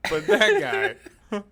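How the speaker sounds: high, slow laughing words. Real, not acted. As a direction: laugh through it. Laughing at the photo.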